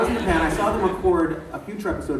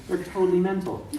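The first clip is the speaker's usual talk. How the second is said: ordinary delivery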